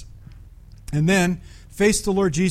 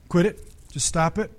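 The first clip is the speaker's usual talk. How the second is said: normal talking voice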